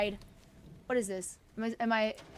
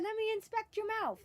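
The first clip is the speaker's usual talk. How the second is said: lightly voiced, higher dog talk; voicing the dog; 7 semitones higher, 4 dB quieter than usual. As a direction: put a small high voice on the dog. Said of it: "He said".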